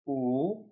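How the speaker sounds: U rising upward; second tone u. Shown as a demonstration. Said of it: "Repeat after me".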